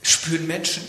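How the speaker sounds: ordinary delivery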